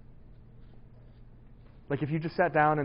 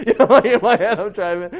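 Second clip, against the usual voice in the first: laughing; 6 semitones higher, 12 dB louder than usual. Real, not acted. Cracking up at own story.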